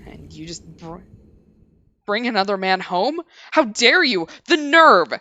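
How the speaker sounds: an exhausted husband raging at his cheating wife, building to loud; rising rant. Acted. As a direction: build from bitter to furious. Man rants at cheating wife.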